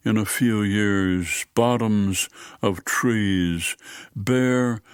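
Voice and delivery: slow, measured poetry reading with long pauses; poem reading. Acted. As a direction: let each line land slowly, like a recited poem. Reads a poem aloud.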